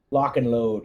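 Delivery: normal talking voice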